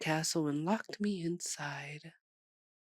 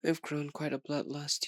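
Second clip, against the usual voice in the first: ordinary talk, nothing marked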